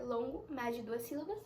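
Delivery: ordinary talk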